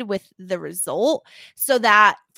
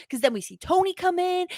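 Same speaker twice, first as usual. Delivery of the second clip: ordinary talk, nothing marked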